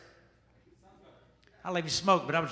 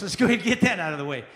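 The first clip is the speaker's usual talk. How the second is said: normal talking voice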